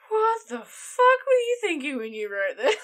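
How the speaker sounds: loud, incredulous outburst at herself; exasperated self-quote. Retold or imitated. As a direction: ask it in loud disbelief. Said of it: "and I'm like".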